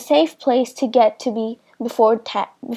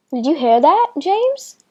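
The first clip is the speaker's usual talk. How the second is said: excited; 4 semitones higher than usual. A narrator's reading. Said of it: "Mark said excitedly".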